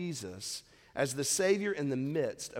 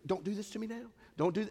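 pleading with computer; 4 semitones higher, faster than usual. Retold or imitated. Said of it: "I start to speak to the computer".

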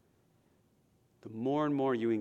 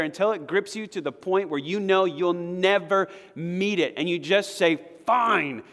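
high, loud, driving sermon voice; full-force preaching; 6 semitones higher, 7 dB louder than usual. Acted. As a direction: preach it up to the rafters.

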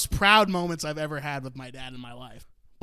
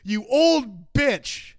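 raised, high acted yell, drawn out at the end; defiant outburst; 7 semitones higher, 6 dB louder, much slower than usual. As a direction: reenact cursing out the TV. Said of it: "I stood up and I said".

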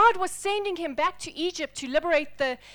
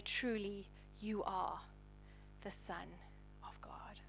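fading softer whisper, lower; reverent whisper; 6 semitones lower, 17 dB quieter, slower than usual. Acted. Disciples on their faces in the boat, fading reverent whisper. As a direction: let awe hush the voice to nothing.

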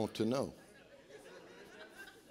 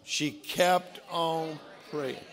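call and response; much slower than usual. Shown as a demonstration. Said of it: "say that with me".

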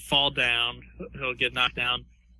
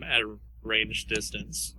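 ordinary talk

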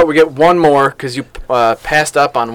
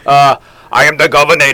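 louder, hammed-up Austrian action-star voice; Schwarzenegger impression; 5 dB louder, faster than usual. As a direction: do a bodybuilder governor, heavy Austrian accent.

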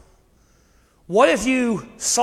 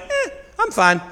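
unbothered shrug; 12 semitones higher than usual. Retold or imitated. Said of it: "cut off Lexi's arm, and she's like".

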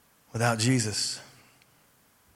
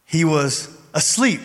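normal talking voice

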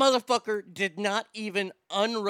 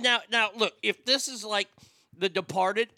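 normal talking voice

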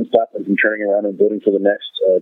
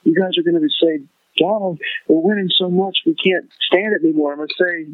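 lightly higher, quoting Trump's boast; Trump impression; 8 semitones higher than usual. Host quoting Trump's winning line to cohost, higher mock voice. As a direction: mimic Trump promising endless winning. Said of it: "that line from Donald Trump where he says".

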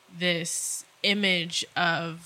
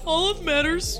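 mocking voice; 9 semitones higher, 4 dB louder than usual. Mocking "all love" reply.

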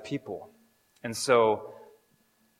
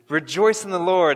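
raised, ringing proclamation; higher, louder announcement; proclaiming; 6 semitones higher, 5 dB louder than usual. Pretend. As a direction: read it out to the whole church. Reader proclaims letter to church.

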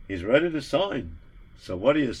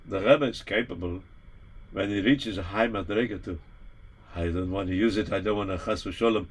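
lower, softer humble helper voice; deferential assistant; 6 semitones lower, 3 dB quieter, faster than usual. Acted. Assistant urges the Rebbe, low and deferential. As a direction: suggest it carefully, eyes down.

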